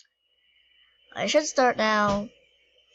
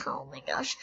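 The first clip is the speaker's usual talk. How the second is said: whisper; 2 semitones higher, 11 dB quieter than usual. Pretend. Guilty whisper.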